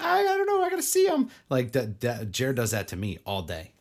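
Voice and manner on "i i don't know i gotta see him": squeaky high mock confusion; whiny high mimic. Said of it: "you know how you feel when I say a name and you're like".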